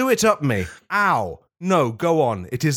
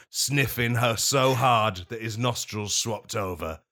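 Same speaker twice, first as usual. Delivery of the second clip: usual speaking voice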